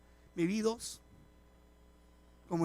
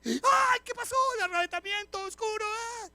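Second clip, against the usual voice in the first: put-on much higher, panicked old woman crying out; 12 semitones higher, 5 dB louder than usual. Old woman waking alone in the dark church, panicked high cry. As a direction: shriek it as if the rapture came.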